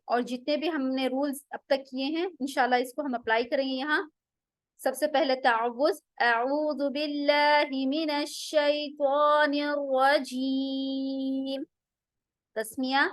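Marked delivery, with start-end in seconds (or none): 6.2-11.6 slow, measured tajweed chant; Quran recitation. Acted. Teacher reciting the taawwuz to begin practice. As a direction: recite it slowly with long held vowels.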